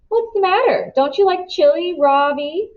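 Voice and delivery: Dylan mock-friendly. A narrator's reading. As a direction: sweetly needle the new kid. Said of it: "he asks".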